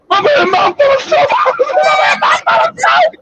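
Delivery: high, squealing through laughter; real laugh taking over the words; helpless laughter. Real, not acted. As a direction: crack up while repeating it. Cracking up at own story.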